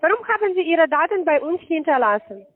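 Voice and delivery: usual speaking voice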